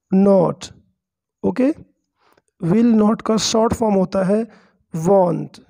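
ordinary delivery

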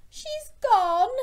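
very high, loud tearful wail; storybook wail, stretched on the last word; mock sobbing. Rita to Gilbert about her loss, high acted wail. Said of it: "Rita sobbed".

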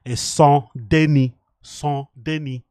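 "Saint Denis" slowly, as "san deni" with no S sounds; slow practice of "Saint Denis" in French, "san deni"; careful French pronunciation. Shown as a demonstration. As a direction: drill "Saint Denis" slowly, the S on Saint and the end of Denis both silent. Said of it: "My French is not the best".

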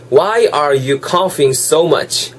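slow, clear model reading; slow model sentence. Shown as a demonstration. Teacher reading the day's sentence slowly for students. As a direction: lay each word out slowly for learners. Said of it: "잘 들어보시면요".

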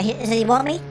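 ordinary delivery

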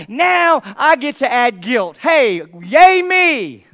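slow, loud, mock-celebratory yay; sarcastic cheer. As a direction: drag out a bitter fake cheer. Sarcastic self-pity about adding guilt.

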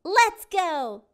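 high, loud eager call. Acted. Callie urges the crew to go, high eager call. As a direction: cheer it out ready to sail. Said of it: "says First Mate Callie".

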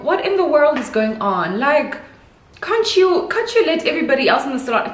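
high, indignant mock protest; outraged inner voice. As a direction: act baffled and annoyed at the staff. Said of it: "I'm like".

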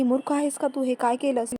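usual speaking voice

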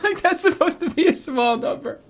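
high-pitched, talking through laughter; cracked up in disbelief; laughing. Real, not acted. Cracking up at the number left.

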